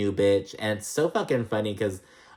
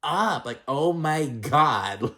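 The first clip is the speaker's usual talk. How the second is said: mock exasperation; 5 semitones higher, much slower than usual. Shown as a demonstration. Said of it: "raise your voice and shut these hoes up".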